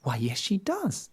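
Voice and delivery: put-on high, surprised little girl. A narrator's reading. Girl surprised by the wolf, high and wide-eyed. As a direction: sound taken aback, innocently. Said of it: "very surprised".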